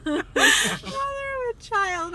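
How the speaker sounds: speaking through high laughter; laughing. Real, not acted. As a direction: break up laughing mid-phrase. Laughing fit over the phrase.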